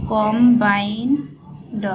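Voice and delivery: Combined stretched out slowly, syllable by syllable; slow drawn-out. Shown as a demonstration. Teacher spelling out a shorthand word for students, slowly. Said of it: "উচ্চারণ করবেন কম".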